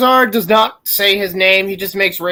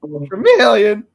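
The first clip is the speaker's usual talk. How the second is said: Pokemon cry; slower than usual. Pretend. Slow Charmeleon cry.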